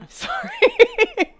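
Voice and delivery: high, slow, cracking up; apologising while laughing hard. Real, not acted. Laughing at own joke.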